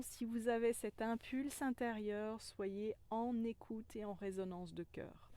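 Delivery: ordinary talk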